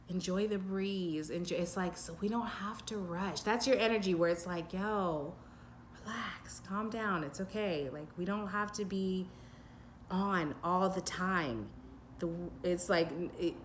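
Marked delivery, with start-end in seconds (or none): 4.7-7.9 softer, gentle calming-down; soothing reassurance. Retold or imitated. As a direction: ease someone's worry, quietly. Voicing the client's laid-back energy.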